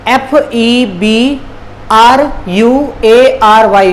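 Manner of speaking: slow, letter by letter; spelling out. Shown as a demonstration. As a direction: spell it out slowly with a gap after each letter. Spelling the month for learners. (एक-एक अक्षर धीरे-धीरे)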